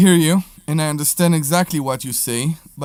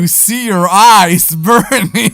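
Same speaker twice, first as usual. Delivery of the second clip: laughs mid-line, louder and higher, a bit slow; laughing; 6 semitones higher, 10 dB louder, slower than usual. Real, not acted. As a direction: tease him through a laugh. Teasing co-host, laughing.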